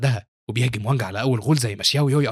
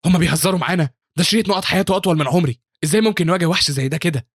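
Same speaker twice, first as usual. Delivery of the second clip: high, louder panicked protest; fearful; 5 semitones higher, 4 dB louder than usual. A narrator's reading. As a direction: panic at an impossible fight. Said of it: "وبيقولوا بخوف".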